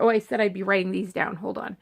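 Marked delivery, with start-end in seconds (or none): none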